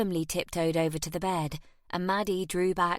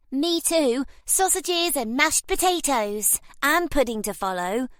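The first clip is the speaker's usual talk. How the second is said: put-on high, bright boyish glee; gleeful; 8 semitones higher, 4 dB louder than usual. A narrator's reading. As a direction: brag it happily. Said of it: "added Henry with glee".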